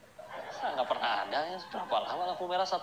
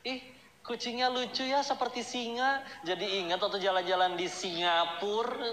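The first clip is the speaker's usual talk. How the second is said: boastful lady; 7 semitones higher than usual. Retold or imitated. A show-off woman admires a cat to brag about Singapore, high and gushing. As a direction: coo over the cat while bragging.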